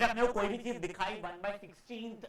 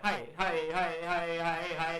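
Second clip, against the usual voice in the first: repeating in the head; 3 semitones lower, slower than usual. Shown as a demonstration. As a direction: run of echoing hi's at an even level. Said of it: "मैं तो घूमे जा रहा". (दिमाग में घूमती "हाय" की गूंज)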